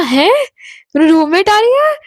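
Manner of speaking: shocked disbelief. Retold or imitated. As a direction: gasp out the question in sudden alarm. Shocked at news of roommate.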